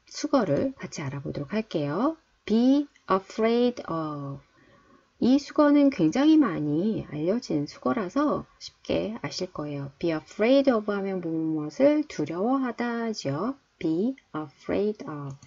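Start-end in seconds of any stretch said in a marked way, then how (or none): 2.4-4.4 very slow, each word drawn out; slow teacher demo; slow model reading. Shown as a demonstration. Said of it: "teacher models phrase slowly". 13.8-15.3 very slow, each word drawn out; slow teacher demo; slow model reading. Shown as a demonstration. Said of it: "teacher models phrase slowly".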